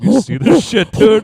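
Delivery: high shouted mock yell. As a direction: yell it like you just got burned. Acting out burning hand on stove.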